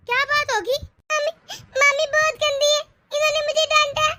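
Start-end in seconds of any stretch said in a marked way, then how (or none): 1.1-4.1 high-pitched tearful accusation; crying child. Acted. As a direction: tattle through tears like a small child. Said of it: "तू इस तरह रो क्यों रहा है". (रोते हुए बच्चे की शिकायत)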